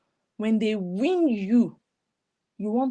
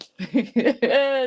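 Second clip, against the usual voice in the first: mocking laugh; 3 semitones higher, 4 dB louder than usual. Real, not acted. Laughing at the guest.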